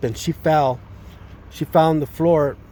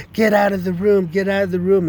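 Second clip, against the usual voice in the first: high, loud mock yell, repeated; retold yelling; 7 semitones higher, 3 dB louder than usual. Sisters yelling at him to leave mom's room, high retold shout. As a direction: bark them out of the room. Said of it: "started screaming and yelling".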